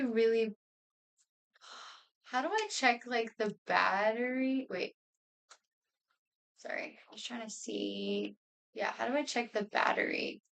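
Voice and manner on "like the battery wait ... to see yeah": ordinary talk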